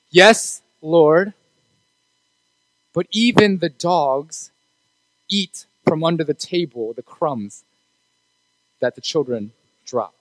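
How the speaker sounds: slow, soft and measured, each phrase set apart; slow deliberate reply. Acted. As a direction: let the woman's answer land word by word. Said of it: "What does she say?".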